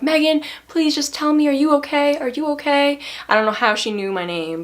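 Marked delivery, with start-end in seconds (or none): 0.0-3.0 high, pleading mock worry; dog owner begging Megan to say she's okay, high; worried lady's voice. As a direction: pitch it up and plead. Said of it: "she was like".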